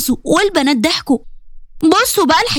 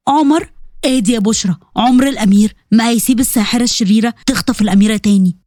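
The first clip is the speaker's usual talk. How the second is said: a hint of a young man comforting; lightly voiced Omar; 6 semitones lower than usual. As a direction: lower male voice, lightly done. Omar reassuring Bushra in the dark.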